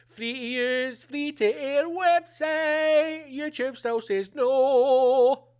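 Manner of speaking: slow, stretched, chanting syllables; mock sing-song complaint. Host moans about a YouTube copyright block, mock sing-song complaint. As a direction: drag out each word in exasperated sing-song.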